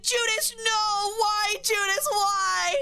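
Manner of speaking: a shrieking sob of disbelief; mock crying wail. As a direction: wail it like a heartbroken fan mid-sob. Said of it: "And he's, like, crying".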